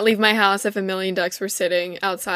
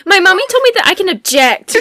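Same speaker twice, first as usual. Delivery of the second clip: put-on childish voice; 8 semitones higher, 3 dB louder, faster than usual. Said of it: "start all your sentences in a childish voice".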